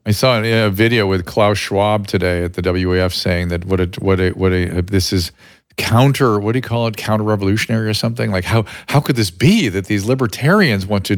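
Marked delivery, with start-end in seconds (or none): none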